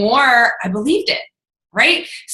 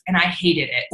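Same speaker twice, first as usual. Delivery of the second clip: usual speaking voice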